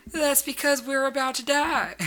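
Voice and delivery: very high, loud Southern wail; hammed-up panic with a slow Southern drawl; Southern accent. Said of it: "All right, southern accent, southern accent".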